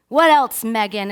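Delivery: loud, high-pitched opening, urging yourself on; forceful shouted prompt; shouted self-demand. Retold or imitated. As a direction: holler it at yourself. Acting out screaming alone in car.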